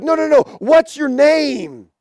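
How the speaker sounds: lightly acted emperor pressing for an answer; insistent emperor. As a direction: brush off the reply and demand another. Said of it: "the emperor's like".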